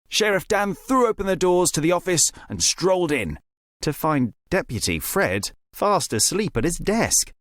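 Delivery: plain reading voice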